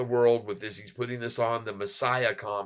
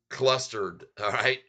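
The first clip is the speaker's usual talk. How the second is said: ordinary talk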